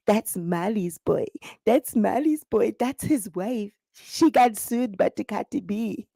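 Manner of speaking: whisper-gossip about the newcomer; gossiping village women. Acted. Village women gossiping about Mali's son's wife, low and slow.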